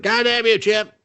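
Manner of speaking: shrill hammed-up curse; high mock outrage. Paralysed hero cursing the chip, high mock outrage.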